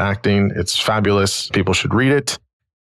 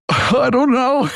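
high, slow, laughing cry of I don't know; exasperated helpless; slower than usual. Retold or imitated. Stumped trainee answering his supervisor, high and laughing.

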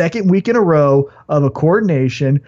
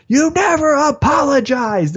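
mock heel taunt; 9 semitones higher than usual. Becky beating her with chair.

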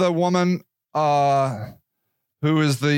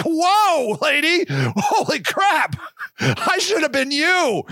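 amazed; 7 semitones higher, slower than usual. Retold or imitated. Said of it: "And that's like".

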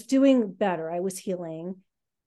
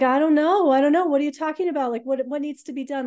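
higher and louder, frantic; panicked bewilderment; 7 semitones higher, 6 dB louder than usual. Retold or imitated. Answering her worried friend, higher, louder and frantic. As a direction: blurt it out in rising alarm.

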